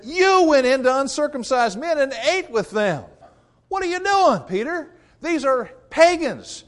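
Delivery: high, loud, outraged accusation; shrill mock outrage at Peter, all the way up. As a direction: critics in Jerusalem scold Peter in high, loud mock alarm. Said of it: "They're freaking out".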